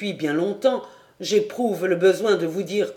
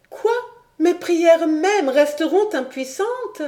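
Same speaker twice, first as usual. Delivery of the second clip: put-on higher young woman's voice, urgent and pleading; Clara pleading; 12 semitones higher, 3 dB louder than usual. Clara begging du Granec not to leave, higher pleading voice. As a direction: beg him to stay, voice lifted.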